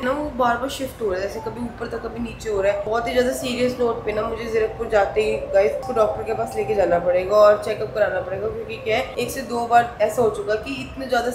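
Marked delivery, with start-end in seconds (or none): none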